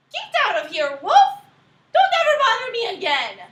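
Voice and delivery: loud, high angry pig shout; rude yell. Acted. Rude third pig yelling at the wolf to leave. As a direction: shoo the wolf away.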